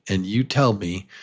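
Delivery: ordinary delivery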